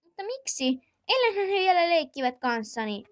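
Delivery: put-on high, young child's voice calling auntie; little child asking auntie. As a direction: play a small whiny kid.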